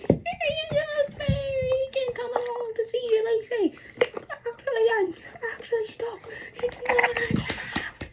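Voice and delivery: high, squealed whine; crashing out. Retold or imitated. Stuck girl crashing out, high whiny mock squeal. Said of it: "I was crashing out and I was like".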